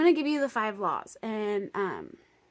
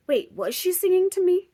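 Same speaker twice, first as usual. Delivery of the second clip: higher and louder, startled; surprised realization; 9 semitones higher, 10 dB louder than usual. Retold or imitated. Wondering if the singer sang to her, surprised. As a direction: catch yourself in sudden wonder.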